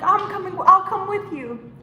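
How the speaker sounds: high, eager imitation of Dorothy; girl in love begging to come along; mock Dorothy. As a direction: chirp it like a smitten fan tagging along. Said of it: "She's like".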